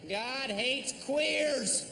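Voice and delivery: slow, drawn-out protest chant; mock right-wing protester. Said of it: "me pretending to be a right-wing".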